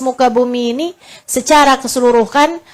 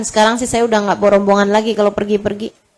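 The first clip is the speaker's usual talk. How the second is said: ordinary talk, nothing marked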